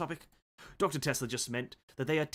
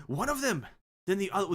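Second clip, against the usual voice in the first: higher British Doyle voice; Conan Doyle British; 6 semitones higher than usual. Acted. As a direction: keep the consistent Doyle accent. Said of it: "the only one that's consistent".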